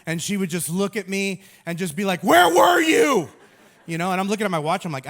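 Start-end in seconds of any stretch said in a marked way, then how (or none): 2.2-3.3 high, loud demanding yell; frazzled shout. Retold or imitated. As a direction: hurl it at him the moment he walks in. Wife snaps at husband coming home.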